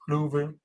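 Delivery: Louisville said as "Luh-vul", two quick syllables; Louisville clipped to "Luh-vul" like a local; local pronunciation. Shown as a demonstration. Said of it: "Trying to say it".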